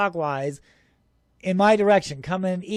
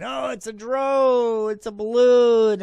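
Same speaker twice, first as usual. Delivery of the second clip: drawn-out, higher mock voice; mocking naysayers; 4 semitones higher, 4 dB louder, slower than usual. UFO filmer mimics people explaining away the sighting, mocking. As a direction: stretch it out slow, mimicking the doubters. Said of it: "a lot of people are saying".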